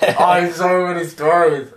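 loud, high, gushing exclamation; real giddy enthusiasm; excited outburst. Real, not acted. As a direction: burst out with it, pitch way up. Excited outburst about favourite subject.

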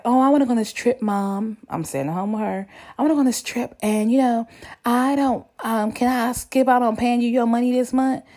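put-on sweet begging child; high pleading request. Asking mom for favor.

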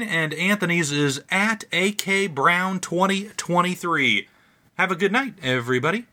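bright, upbeat sign-off to listeners; podcast outro. Real, not acted. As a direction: wrap up the show like a host.

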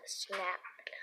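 whispered narration. A narrator's reading.

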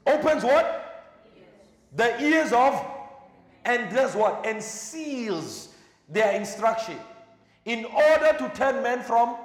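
high, loud sermon cadence, calling out each phrase; full-force preaching. Acted. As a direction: thunder it from the pulpit with pauses.

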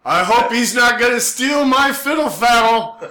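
high, loud comic fretting; mock worry. Onlooker fretting about the mohawk man, mock worry. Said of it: "Everybody else is like".

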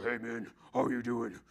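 lightly put-on manly voice; a hint of imitated he-man greeting; Ken Graves. Preacher imitating the conference speaker's greeting, manly voice. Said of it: "he just has like this manly voice".

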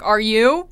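"Are you?" thrown back loud, rising at the end; pointed retort. Retold or imitated. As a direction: punch "Are you?" as a loud retold comeback. Retold retort to impatient date.

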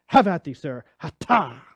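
loud mock duelist challenge, old-timey; hammed-up gentleman throwing down the glove; stagey fake archaic declaiming. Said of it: "take that glove off and say".

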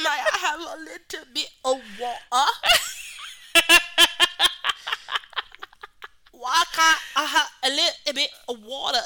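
high, pleading orphan voice through laughs; Tiny Tim plea. Pretend. Host imitates Tiny Tim begging for water, high pleading. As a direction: beg politely like a poor little orphan. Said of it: "Tiny Tim".

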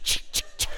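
unvoiced rattling ch ch ch; whispered machine chatter. Imitating the MRI shaking for the ladies. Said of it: "the thing is shaking".

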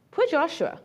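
usual speaking voice

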